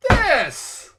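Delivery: This! blurted high and loud; indignant outburst. Real, not acted. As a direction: spit out This! in outrage. Ranting about the film choice.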